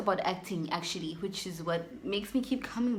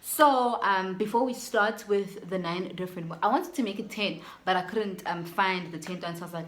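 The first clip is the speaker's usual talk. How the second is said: ordinary delivery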